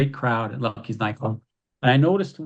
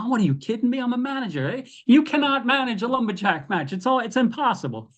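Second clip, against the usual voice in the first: incredulous protest; 10 semitones higher than usual. Retold or imitated. Manager protests the stipulation.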